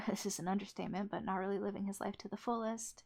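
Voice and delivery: fast, rushed aside after a laugh; rapid nonstop chatter. Real, not acted. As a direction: hurry through it as a throwaway.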